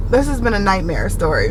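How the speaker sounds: usual speaking voice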